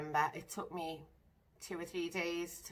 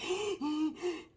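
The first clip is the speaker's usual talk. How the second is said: retold high gasp; 11 semitones higher than usual. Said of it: "I was like".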